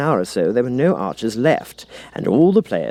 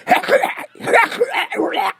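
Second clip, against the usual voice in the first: sudden high shriek of a cry; griffin exclamation. A narrator's reading. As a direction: let out one sharp creature cry. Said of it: "an occasional exclamation of".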